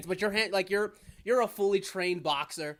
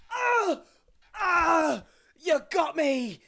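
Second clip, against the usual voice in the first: high, loud hammed-up dying cowboy; mock death throes; 8 semitones higher, 5 dB louder, much slower than usual. Cowboy hit by a dart, hammy dying cries. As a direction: draw out a pretend fatal hit. Pretends to be shot.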